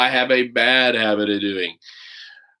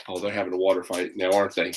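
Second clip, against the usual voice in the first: ordinary delivery, nothing marked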